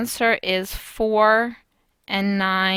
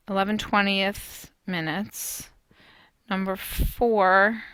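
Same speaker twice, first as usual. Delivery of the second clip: usual speaking voice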